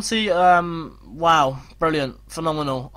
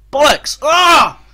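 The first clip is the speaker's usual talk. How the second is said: loud, higher swearing shout; furious outburst; 9 semitones higher, 11 dB louder, much slower than usual. Real, not acted. Gamer swears as the rival scores. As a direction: blurt it out in anger. Conceding a goal.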